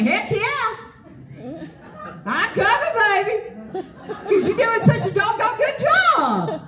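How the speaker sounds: high and laughing, tossed off with a shout; gleeful laughing. Retold or imitated. Speaker retorts to residents about rent raises, laughing. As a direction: crow it back at them cheerfully.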